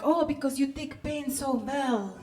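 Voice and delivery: comic Russian waxer, unhurried; attempted Russian accent. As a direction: draw it out in a put-on Russian accent. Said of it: "attempt a Russian, a Russian accent".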